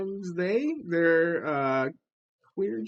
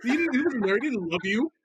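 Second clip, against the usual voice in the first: mock mom; 4 semitones higher than usual. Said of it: "mock mom comforting daughter".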